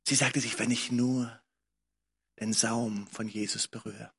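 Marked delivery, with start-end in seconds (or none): none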